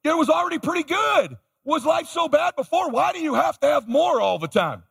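hammed-up nagging relatives shouting; mock family outcry. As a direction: throw up your hands at his foolishness. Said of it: "My family's like".

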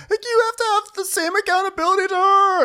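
very high exaggerated demand; mock screech. Host mimics housewife demanding accountability, mock screech. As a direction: squeal it as an outraged housewife.

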